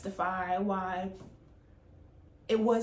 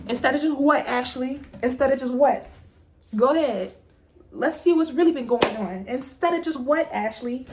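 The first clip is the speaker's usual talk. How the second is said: louder, pressing demand; confronting the coworker, louder; heated challenge; 3 semitones higher, 7 dB louder than usual. Real, not acted. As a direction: dare her to finish the sentence. Challenging Ashley in retelling.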